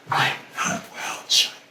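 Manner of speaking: breathless whisper, barely there; whispered confession. Acted. Woman whispering to the child that she is not well, hushed. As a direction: whisper it so only the child hears.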